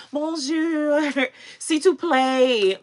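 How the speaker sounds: slow playful French words; mock French. Teasing a Francophile viewer, playful French. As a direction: savour bonjour and s'il te plaît, slowly, in French. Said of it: "Our resident Francophile".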